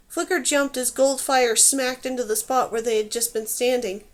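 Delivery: ordinary delivery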